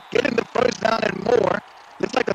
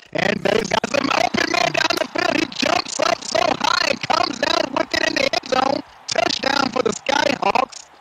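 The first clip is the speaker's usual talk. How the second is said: excited; 8 semitones higher than usual. Real, not acted. Touchdown call, play-by-play.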